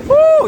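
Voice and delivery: high, loud woo; excited whoop. Real, not acted. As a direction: yell at the strong wind. Wind gets strong.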